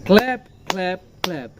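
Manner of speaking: Clap stressed with an aspirated opening k; stressed word. Shown as a demonstration. Teacher showing pupils how to stress clap. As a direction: lean on each clap. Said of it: "শব্দটাকে একটু loudly বলতে হয়".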